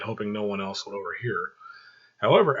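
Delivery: ordinary delivery, nothing marked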